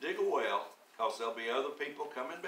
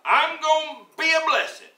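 much higher, louder proclamation; proclaimed; 13 dB louder than usual. Acted. Preacher urging the congregation, loud and high. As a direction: ring it out like a new motto. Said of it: "But how about".

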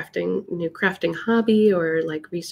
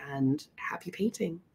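soft whisper; 10 dB quieter than usual. Real, not acted. Whispered sign-off to viewers.

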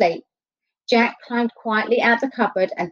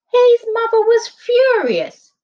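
high, slow, outraged narration; furious; 14 semitones higher, 6 dB louder, slower than usual. A narrator's reading. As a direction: read it like she's boiling over. Narrating angry mother.

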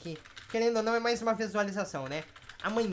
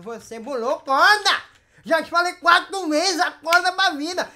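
loud, higher telling-off; exasperated scolding; 5 semitones higher, 6 dB louder than usual. Real, not acted. Game host telling off a player for cheating, scolding.

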